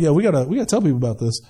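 low, quick imitation of a worldly guy; secular world. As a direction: toss it off like an excited outsider. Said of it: "the secular world's like".

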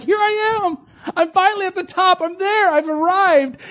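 high, self-satisfied mock boast; smug arrival. Preacher mocking a believer who thinks he's arrived, high smug boast. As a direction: crow about reaching the top.

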